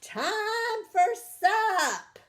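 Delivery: very high, loud, slow holler; grandma hollering the animals in to supper; yelled. Acted. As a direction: holler across the farm for supper. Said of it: "Grandma yelled".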